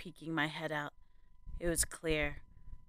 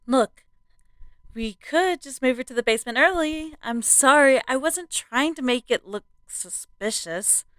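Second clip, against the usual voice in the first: nervous suggestion; 7 semitones higher, 3 dB louder than usual. A narrator's reading. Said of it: "she, she suggested".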